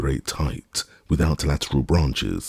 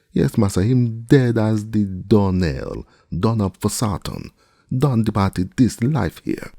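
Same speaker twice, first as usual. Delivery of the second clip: much higher, slow mournful call; dramatic dread; 8 semitones higher, 3 dB louder than usual. A narrator's reading. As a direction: lament it down from the tree. Jupiter reports dead limb dramatically.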